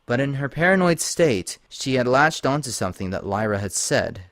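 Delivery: ordinary delivery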